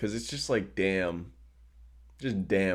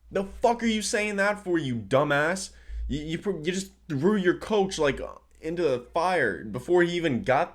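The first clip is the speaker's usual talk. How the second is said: high-pitched, exasperated telling-off; heated rant; 8 semitones higher than usual. Real, not acted. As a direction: rant at him in heated disbelief. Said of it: "I'm really heated right now".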